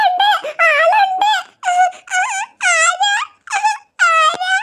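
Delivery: put-on high, squeaky small-child voice; little duckling voice. Duckling tells its sister it will check the door, in a high squeaky voice. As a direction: answer the door like a tiny duckling.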